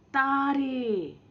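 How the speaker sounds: तारे stretched slow and high at the end; drawn-out plural. Shown as a demonstration. Teacher modelling तारे for pupils, slow and high. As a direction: draw out तारे slowly for the children. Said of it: "शब्द का उच्चारण करते हैं".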